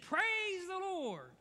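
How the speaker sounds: very high, slow, stretched out praise; joyful shout of praise. Retold or imitated. Old lady finding the groceries, very high slow praise. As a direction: ring it out, overjoyed. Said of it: "She said".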